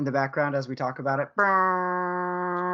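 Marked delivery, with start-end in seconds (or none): 1.3-2.7 imitated goal horn buzz; horn noise. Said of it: "Jim Rome style".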